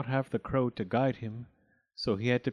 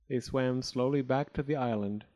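ordinary delivery

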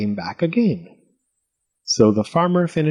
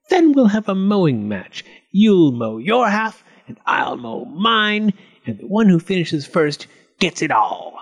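gloating goblin; 7 semitones higher than usual. Acted. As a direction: spell out the challenge slyly. Goblin proposes a mowing match.

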